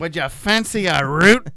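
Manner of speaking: loud mock Australian; Australian accent. Finishing his pickup line in mock Australian. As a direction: ham up the Aussie slang. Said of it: "go Australian".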